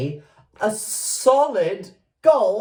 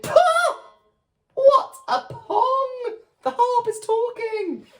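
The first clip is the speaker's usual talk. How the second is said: shrill harp shout. Acted. Singing harp shouts to wake the giant. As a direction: shriek it like a tiny offended harp. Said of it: "the harp shouted".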